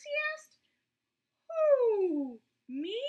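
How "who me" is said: hooted who, higher and louder; owl hoot. A narrator's reading. Owl hooting at Roy's question, louder.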